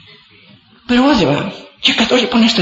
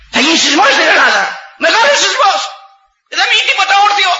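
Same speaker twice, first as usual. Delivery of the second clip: prophetic outcry; 12 semitones higher than usual. Real, not acted. Elder prophesies an earthquake.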